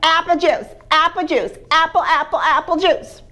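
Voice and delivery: put-on high, loud whiny toddler chant; screaming baby. Baby screaming for apple juice at breakfast, high loud whiny chant. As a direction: wail it like a frantic baby. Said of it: "who was now screaming".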